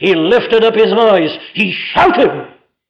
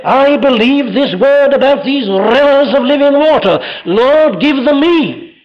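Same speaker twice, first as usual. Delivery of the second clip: higher, louder pleading cry to the Lord; fervent prayer; 7 semitones higher, 3 dB louder than usual. Acted. Believer crying out to the Lord for the rivers, fervent. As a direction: beg the Lord with full fervour. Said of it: "You say".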